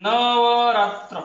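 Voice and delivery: very slow, each sound stretched; slow teacher's demo of Navratra; drawn out. Shown as a demonstration. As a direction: spell it out slowly for the class. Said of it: "एक उदाहरण सांगतो बघा".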